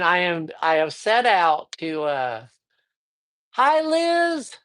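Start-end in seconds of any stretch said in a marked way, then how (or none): 3.5-4.6 high, ringing call to a viewer; excited live-stream greeting; happy shout-out. Real, not acted. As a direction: call out a joyful hello.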